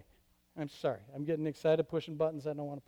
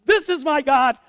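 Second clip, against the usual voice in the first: much louder, higher, triumphant; shouted proclamation; 7 semitones higher, 12 dB louder than usual. Acted. Elijah shouting at the storm, sure it's God. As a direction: cry it out to the sky.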